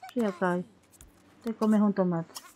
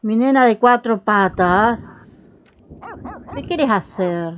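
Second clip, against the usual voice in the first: doting pet talk; 6 dB louder, slower than usual. Pretend. Cooing at pet dog.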